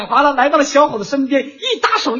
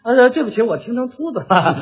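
usual speaking voice

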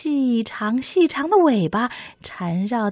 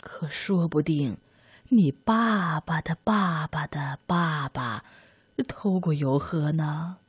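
put-on old granny voice; 5 semitones lower, 6 dB quieter than usual. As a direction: murmur it like a teasing old woman. Grandma answers mouse.

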